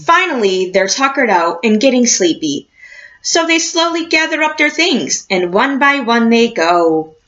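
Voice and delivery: lively picture-book read-aloud with a slow closing question; reading to children. A narrator's reading. Teacher reading a picture book to her class, storybook voice.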